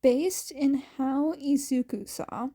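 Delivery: plain reading voice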